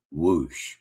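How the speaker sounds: low falling whoosh; imitated whoosh. Host mimicking caller's last thing to say, low whoosh. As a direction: drop the whoosh way down in pitch.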